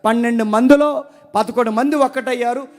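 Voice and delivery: loud, high, ringing sermon delivery; fiery preacher calling out; full-force preaching. Acted. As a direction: drive it home to the congregation. Preacher listing obedient biblical figures.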